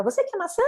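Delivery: "Você quer maçã?" pitched high like a sweet offer; question intonation. Shown as a demonstration. Parent offers the child an apple, question intonation. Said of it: "Tem toda a entonação bonitinha de pergunta".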